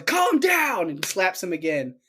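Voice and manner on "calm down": high-pitched retold yell; hammed-up, high command; mock yell. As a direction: imitate yelling an order.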